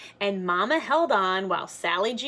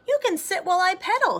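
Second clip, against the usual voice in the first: friend's offer; 11 semitones higher, 5 dB louder, much faster than usual. Acted. Giant friend offers ride.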